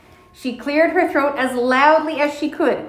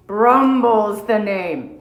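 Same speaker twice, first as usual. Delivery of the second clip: sleepy bear; 2 semitones lower, much slower than usual. Acted. Old bear introducing himself to Mona, slow and drowsy. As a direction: drawl it half asleep.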